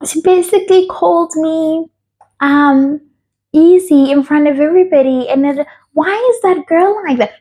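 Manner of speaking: high-pitched imitation of a woman complaining; feminine impression of her. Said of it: "this is how she speaks".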